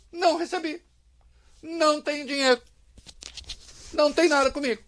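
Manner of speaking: high-pitched, spaced-out disclaimer; company's mock denial. Commentator voicing the production company, denying everything. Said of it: "A Goap falando".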